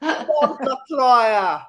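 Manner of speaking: hammed-up Italian exclamation, slowed right down; Italian accent. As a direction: drawl it out like an Italian curse.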